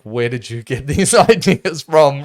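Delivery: drawn-out, half-laughing retort to a talking head; incredulous reaction. Retold or imitated. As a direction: act out baffled disbelief with a laugh in it. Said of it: "I'm just like".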